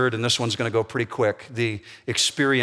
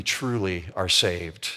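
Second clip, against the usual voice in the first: usual speaking voice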